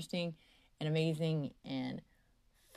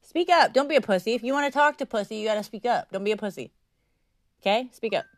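loud, high burst, easing toward the end; real scolding demand; 6 semitones higher, 7 dB louder than usual. Real, not acted. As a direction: order a faint caller to speak up. Scolds a barely audible caller.

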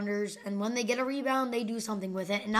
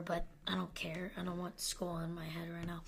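hushed aside whisper; 5 semitones lower, 16 dB quieter than usual. Real, not acted. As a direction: whisper it under your breath. Joking aside about rounding rule.

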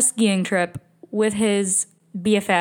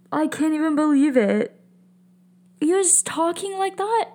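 disbelief; 7 semitones higher than usual. Real, not acted. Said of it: "disbelief at messages".

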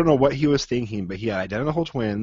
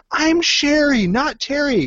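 put-on high, loud girl shouting back; indignant kid yell; 6 dB louder, slower than usual. Daughter correcting her yelling father, high indignant shout. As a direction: snap back at dad like an offended kid.

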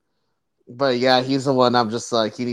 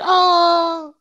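shocked gasp; 6 dB louder than usual. Retold or imitated. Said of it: "I was like".

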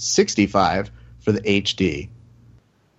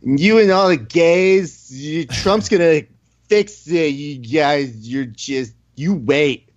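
slow, higher mock drunk woman; 3 semitones higher, slower than usual. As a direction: slur it like a tipsy woman shouting outside a bar. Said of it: "a drunk woman ... just goes".

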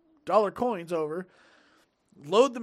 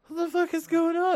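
high-pitched mock disbelief; imitated bewilderment; 11 semitones higher, 4 dB louder than usual. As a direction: squeak it out like a baffled friend. Kyle acted as bewildered.

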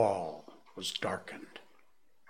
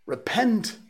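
"Repent" flung out much higher and louder; cried out; 12 semitones higher, 6 dB louder than usual. A narrator's reading. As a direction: hurl "Repent" like a prophet's cry. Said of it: "called upon them to repent".

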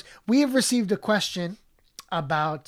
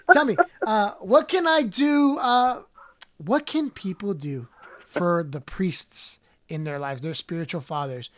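put-on a thirty-something Mexican guy's accent. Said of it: "thirty-something receding hairline Mexican".

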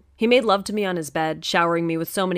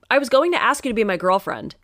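usual speaking voice

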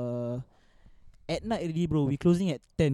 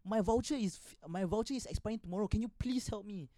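demanding customer; 6 semitones higher than usual. Retold or imitated. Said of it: "she went to my manager".

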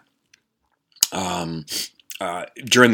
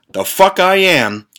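slow, loud and high refusal; defiant retort; 10 semitones higher, 6 dB louder, slower than usual. Retold or imitated. Refusing the ogre on the stairs, loud and slow. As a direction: spit it out as you bolt. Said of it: "And I said".

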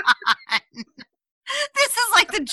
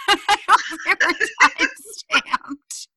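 laughing; 6 semitones higher, slower than usual. Real, not acted. Laughing while joking.

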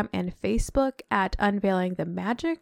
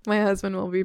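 ordinary talk

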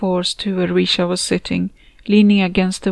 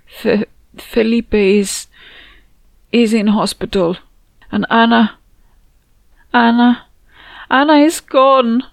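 breaking, tearful and louder, words faltering; crying; 4 semitones higher, 5 dB louder than usual. Acted. Mother tells her friend her daughter is gone, sobbing. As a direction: choke the news out through tears. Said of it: "She was crying".

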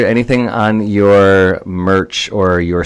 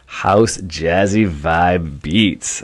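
very slow, relishing echo; much slower than usual. Real, not acted. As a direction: stretch every word out. Echoing the phrase slowly.

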